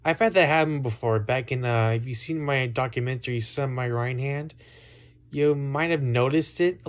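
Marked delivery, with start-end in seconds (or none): none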